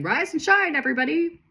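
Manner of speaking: high, sing-out wake-up yell; hammed-up morning shout from Mom. A narrator's reading. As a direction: holler the household awake, pitch way up. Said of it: "slamming doors and yelling".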